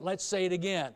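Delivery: ordinary talk, nothing marked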